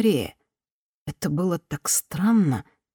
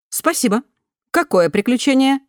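higher, louder, polished newsreader tone; news reader voice; 8 semitones higher, 4 dB louder than usual. A narrator's reading. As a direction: read it like a news bulletin. Голос системы спрашивает. (голос диктора новостей)

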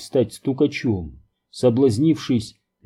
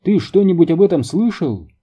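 higher-pitched hail to a colleague; calling out; 6 semitones higher, 4 dB louder than usual. A narrator's reading. Рут hailing a colleague across the lab, calling out. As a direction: call over with a mild narrated shout. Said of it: "крикнула Рут".

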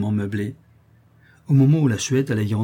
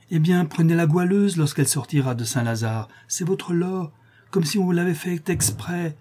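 put-on higher voice for a pushy old schemer; wheedling old crone; 7 semitones higher than usual. Old woman pushing her bargain on the countess, wheedling. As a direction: pitch up, as the crone pressing her offer.

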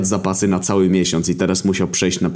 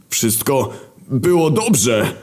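higher, slow, pleading protest; War's voice, protesting; 8 semitones higher, much slower than usual. Acted. War protesting to his girlfriend, high pleading voice. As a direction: drag it out defensively.

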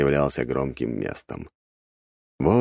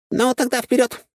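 high, light talking squirrel; chirpy little creature voice. Acted. Squirrel cheers.

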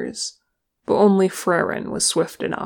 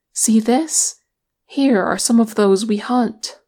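raised, higher call to companions; crying aloud; 4 semitones higher, 5 dB louder than usual. A narrator's reading. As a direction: call out a discovery, pitched up. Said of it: "Legolas cried aloud".

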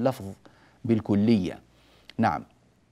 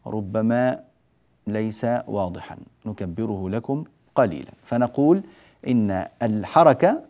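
usual speaking voice

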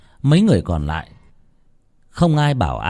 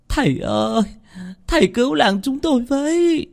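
much higher, tearful begging; whimpering plea. Acted. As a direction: whimper for the master to save the village. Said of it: "bà Hằng Nước mếu máo". (mếu máo van xin thầy cứu làng)

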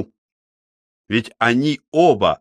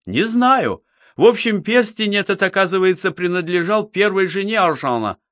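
lightly voiced eager young man, higher; a hint of an eager youth reporting; young Mitya voice; 8 semitones higher than usual.

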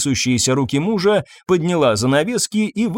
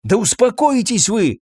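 ordinary delivery

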